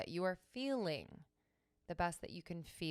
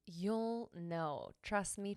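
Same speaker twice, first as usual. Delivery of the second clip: normal talking voice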